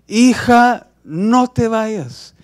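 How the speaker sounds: high, loud, drawn-out wail; desperate cry. Acted. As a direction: scream after a child slipping away. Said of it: "a gritar desesperadamente". (grito desesperado, alto y alargado)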